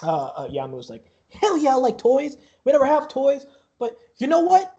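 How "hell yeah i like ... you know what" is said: put-on high, loud childish enthusiasm; excited kid. Yamu answering the barker about toys, loudly eager.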